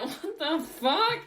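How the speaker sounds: put-on slow, drawn-out kid's baffled query; small kid. Small kid baffled at his brother, slow drawn-out voice. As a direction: stretch it out like a confused little kid. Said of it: "the small kid is like".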